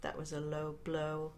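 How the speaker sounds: low, soft, slow mutter. A narrator's reading. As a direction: mutter it under your breath, hurt. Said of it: "Arthur muttered".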